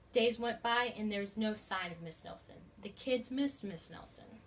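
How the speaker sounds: ordinary delivery, nothing marked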